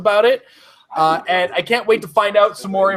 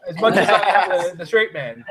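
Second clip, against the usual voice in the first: ordinary talk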